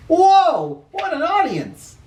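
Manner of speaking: wide-eyed amazement; amazed. Acted. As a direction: gasp at the size of the crowd. Narrator marvels at crowd.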